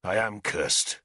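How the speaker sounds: low, slow bitterness; bitter. A narrator's reading. Arthur answers Nimue's threat, bitterly. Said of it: "he answered bitterly".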